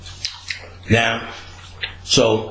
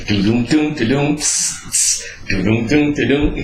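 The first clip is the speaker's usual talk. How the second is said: beatbox; 3 semitones higher than usual. Retold or imitated. Mimicking skateboard beat while high.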